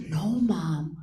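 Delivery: soft, slow whispered reveal; hushed whisper. Retold or imitated. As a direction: lean in and breathe it out slowly. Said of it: "She's like".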